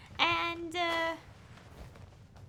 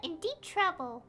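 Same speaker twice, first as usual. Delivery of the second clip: high-pitched companion recalling past trouble; complaining game character; 9 semitones higher than usual. Acted. Streamer voicing a companion doubting the expert, complaining. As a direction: voice a reproachful line from the game.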